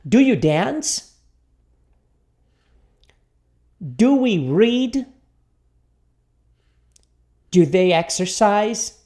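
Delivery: slow questions with long gaps for response; repeat-after-me model. Shown as a demonstration. Teacher leads students through questions, slow and deliberate. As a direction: say each question slowly, then wait. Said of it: "Vamos lá, repita comigo".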